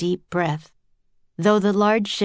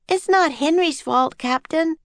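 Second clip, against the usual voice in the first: put-on a much higher, eager child's voice; piping up; 10 semitones higher than usual. A narrator's reading. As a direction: chime in brightly and high, like a young girl. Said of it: "Annie piped up".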